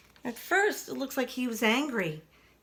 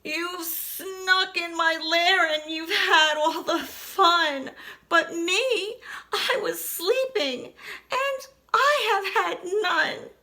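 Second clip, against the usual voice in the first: high, blubbering bear voice; whiny crying bear; 6 semitones higher than usual. Acted. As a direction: wail like an upset big bear. Bear whines sadly.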